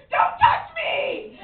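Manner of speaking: high, drawn-out mock scream; shouting bunny. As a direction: shriek it high, stretching each word. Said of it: "jump into the air and shout".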